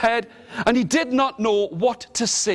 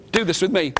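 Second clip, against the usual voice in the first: usual speaking voice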